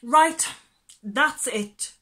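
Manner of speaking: loud, cross outburst; fuming. A narrator's reading. The wasp fed up with the laughter, loud fuming. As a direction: snap in fed-up anger. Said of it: "fumed the aha, ha".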